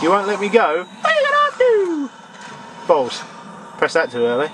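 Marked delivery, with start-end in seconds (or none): 0.9-2.1 squeaky panicked challenge; high shriek. Real, not acted. Gamer shrieking at the ghost.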